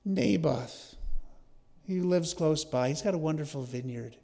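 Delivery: hammed-up self-pitying moan of a sulking king; mock lament. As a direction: lament with mild, put-on misery. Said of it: "He was sitting there lamenting".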